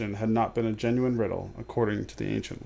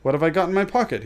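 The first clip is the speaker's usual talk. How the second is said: higher, louder, quick insistence; louder repeated question; 6 semitones higher, 5 dB louder, faster than usual. A narrator's reading. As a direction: press the question harder. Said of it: "he said louder".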